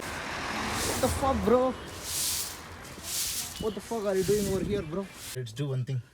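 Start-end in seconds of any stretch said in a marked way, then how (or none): 0.0-5.1 hushed, stunned whisper; whispered shock. Real, not acted. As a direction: breathe out the disbelief. Shocked at heartbeat reading.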